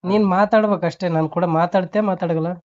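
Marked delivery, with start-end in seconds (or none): none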